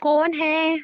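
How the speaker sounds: angry high-pitched demand; shouted demand. Acted. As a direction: demand an answer, voice up. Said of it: "चिल्लाओ मत".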